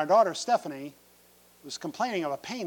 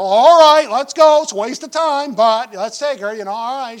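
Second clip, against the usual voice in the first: loud, higher, impatient brush-off; dismissive parents; 7 semitones higher, 15 dB louder than usual. Retold or imitated. Parents grudgingly agreeing to take daughter to hospital, loud and impatient. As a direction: wave it off as a waste of time.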